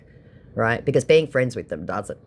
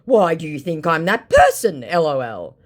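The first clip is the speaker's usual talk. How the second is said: mock scammer voice; 5 semitones higher, 9 dB louder than usual. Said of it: "my scammer said".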